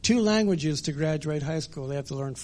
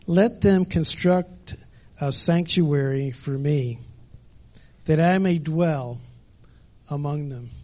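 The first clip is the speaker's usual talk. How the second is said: slow, louder solemn reading; preacher voicing God to Moses; scripture reading; 4 dB louder, slower than usual. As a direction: proclaim God's words deliberately. Reads God's words in Exodus.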